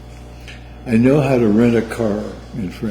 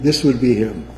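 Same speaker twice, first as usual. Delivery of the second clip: lightly put-on French accent, clerk at the counter; mock French accent; 2 semitones lower, 5 dB quieter than usual. Mother imitating French car rental clerk, light French accent. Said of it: "your French accent".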